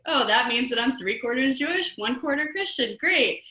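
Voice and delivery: wise guy. Retold or imitated. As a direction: chirp it like a pleased little wise guy. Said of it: "wise guy that I am".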